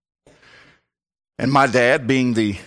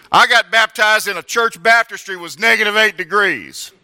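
ordinary delivery

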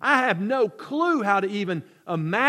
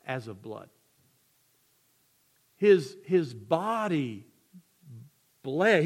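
solemn sermon; 5 semitones lower, slower than usual. Acted. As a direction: preach it heavy and hushed. Solemn slow preaching fading down.

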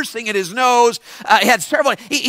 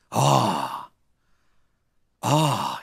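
long, low, soft groan of a word; imitating odd kid; 11 semitones lower, 8 dB quieter than usual. Mohawked kid hesitates before answering preacher, low slow imitation. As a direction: draw it out low and hushed. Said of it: "He goes like this".

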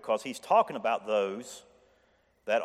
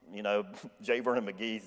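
normal talking voice

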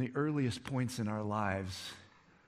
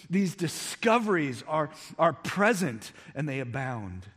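high, loud, driving preacher voice; full-force preaching; 8 semitones higher, 9 dB louder than usual. Acted. Pastor rousing his congregation, loud and high. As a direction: preach it out to the whole room.

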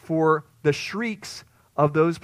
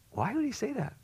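puzzled question; 9 semitones higher, 7 dB quieter than usual. Pretend. Preacher voices puzzled question.

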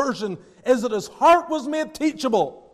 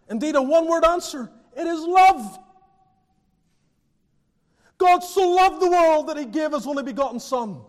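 raised, fervent sermon climax; impassioned preaching; 6 semitones higher than usual. Acted.

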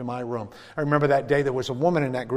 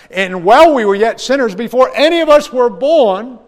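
much higher and louder, rising to the peak; fervent pulpit swell; preaching climax; 10 semitones higher, 14 dB louder than usual. Acted. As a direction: build the verse to a ringing cry. Quoting scripture at climax.

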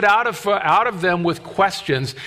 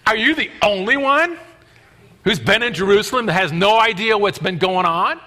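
sarcastic; 2 semitones higher than usual. Acted. Cleopas needling the stranger who seems to know nothing, sarcastic. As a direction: ask it with pointed sarcasm. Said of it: "I can see the sarcasm in it".

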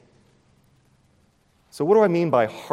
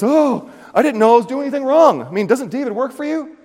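high, louder innocent protest; pleading priest; 12 semitones higher, 9 dB louder than usual. Retold or imitated. Priest Ahimelech protesting to King Saul, high and pleading.

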